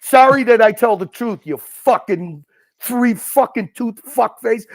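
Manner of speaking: normal talking voice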